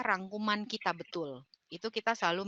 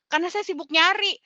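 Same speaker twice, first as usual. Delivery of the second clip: fast, high, flustered searching for a question; rushed and high, scrambling for words; 10 semitones higher, 5 dB louder, faster than usual. Shown as a demonstration. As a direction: act out a coach losing her next question in a panic. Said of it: "aduh sibuk sendiri".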